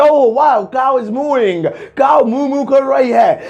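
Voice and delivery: high, hammed-up excitement, slow on cow is mooing; mock excited bystander. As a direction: gush over the mooing cow. (बनावटी उत्साह से)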